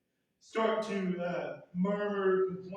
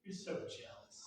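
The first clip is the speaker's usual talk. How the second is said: breathy whisper, much softer, straight at them; whispered line; 11 dB quieter than usual. Shown as a demonstration. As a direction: whisper it to their face. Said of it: "look them square in the face and go".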